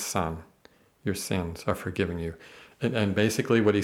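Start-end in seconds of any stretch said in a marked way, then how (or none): 0.0-2.3 tender. Retold or imitated. Jesus gently forgiving the paralyzed man, soft and low. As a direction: lower and softer, tender and gentle.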